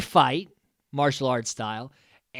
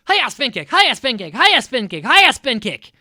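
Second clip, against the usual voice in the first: much higher and louder, repeated battle cries; mock kung fu yells; 8 semitones higher, 12 dB louder than usual. Tommy practising alone in a room, loud mock karate shouts. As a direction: bark it like a karate kid showing off. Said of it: "he's just spin kicking".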